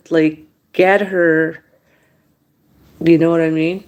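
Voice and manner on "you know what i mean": ordinary delivery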